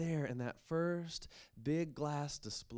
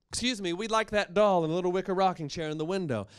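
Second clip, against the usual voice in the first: loud and brisk; 6 semitones higher, 10 dB louder, faster than usual. Acted. Mother addressing shopkeeper.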